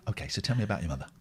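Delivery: lightly played therapist, low and soft; pretend therapist. Pretend therapist asks about mother, lightly played low and soft. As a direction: drop into a quiet, low counselling voice. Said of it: "in that accent, but it'd be".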